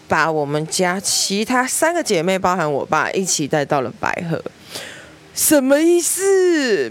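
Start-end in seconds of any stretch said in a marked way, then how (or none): 5.3-6.9 high, slow, drawn-out disbelief; why am I even going; baffled protest. Retold or imitated. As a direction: stretch it out like you can't believe it. Protesting being dragged along.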